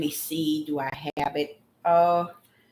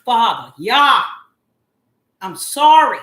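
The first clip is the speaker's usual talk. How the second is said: screaming loud; 3 semitones higher, 12 dB louder than usual. Real, not acted. Apologizing to Father Yah, screaming loud. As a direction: belt out the apology to God. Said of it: "to scream loud".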